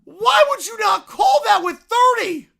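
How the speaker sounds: loud, high, slow incredulous yell; exasperated shout. Real, not acted. Fan shouting at Todd Bowles over the clock, exasperated yell. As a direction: bellow the question in disbelief. Yelling at coach's clock management.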